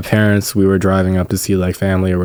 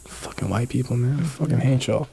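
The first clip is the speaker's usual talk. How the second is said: mock muttered rant; 2 semitones higher, 10 dB quieter than usual. Joking mock rant, then kidding.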